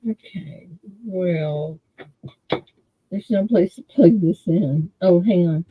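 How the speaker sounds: ordinary talk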